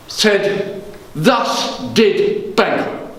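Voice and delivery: theatrical Shakespearean king, brooding and commanding; measured stage verse with deliberate pauses, pitched above everyday talk; Macbeth. Acted. King broods on Banquo, then goads the murderers, in dramatic verse. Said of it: "I will be performing as Macbeth".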